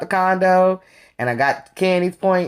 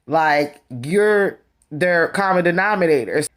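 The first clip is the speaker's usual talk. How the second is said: ordinary delivery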